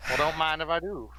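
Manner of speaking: put-on folksy Southern cowboy accent. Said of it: "use the cowboy voice".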